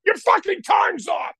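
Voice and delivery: high-pitched angry yell, cutting the song dead; high, outraged retold scream; screaming bandmate. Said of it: "he'd scream at me".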